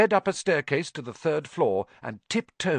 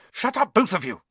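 raised, higher-pitched sharp order; 9 semitones higher, 5 dB louder than usual. A narrator's reading. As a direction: cut them off sharply. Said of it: "said Harry sharply".

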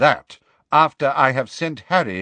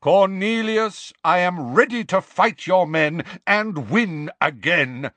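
put-on slow, louder, commanding old wizard; thunderous; 6 semitones higher, 3 dB louder than usual. Dumbledore confronts the minister, thunderous and slow. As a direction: lay down the truth with force. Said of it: "in a thunderous voice".

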